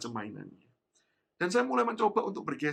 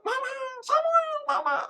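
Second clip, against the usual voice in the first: put-on child calling out; 7 dB louder than usual. Lost little boy answering his mother's call, high and relieved.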